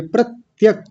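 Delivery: प्रत्यक with short a, never प्रत्येक; pratyak, not pratyek. Shown as a demonstration. Sanskrit teacher corrects a student's word, clearly. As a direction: model प्रत्यक cleanly. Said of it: "प्रत्येक नहीं बोलेंगे".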